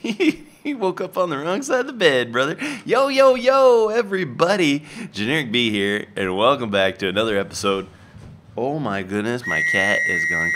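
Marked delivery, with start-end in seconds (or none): 2.8-4.8 loud, high hyped greeting; hyped intro. Real, not acted. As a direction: pump up the viewers. YouTube intro shout.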